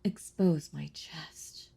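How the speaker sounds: slow, taunting whisper. Acted. Vampire daring the hunter to stake her, slow whisper. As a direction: whisper it slowly as a dare.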